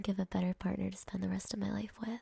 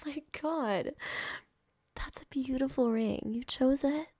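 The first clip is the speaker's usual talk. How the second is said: high, emotional, overjoyed; tearful squealing yes; 4 semitones higher than usual. Acted. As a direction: squeal with joy, close to tears. Accepting a marriage proposal.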